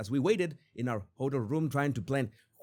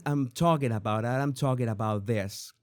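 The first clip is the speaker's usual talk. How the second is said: ordinary delivery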